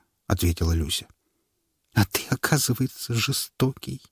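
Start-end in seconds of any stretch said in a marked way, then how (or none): 1.9-4.0 hurt. Acted. Wife hurt.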